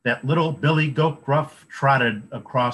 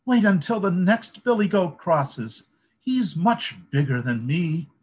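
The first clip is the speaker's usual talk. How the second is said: higher pleading little goat; littlest goat voice; 7 semitones higher than usual. A narrator's reading. Littlest goat bargains with the troll in a small voice.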